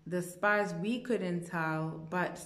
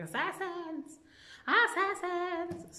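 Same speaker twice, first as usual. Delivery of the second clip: imitated scream; 11 semitones higher, slower than usual. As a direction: call out an alarm in a high, drawn-out voice. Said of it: "I screamed".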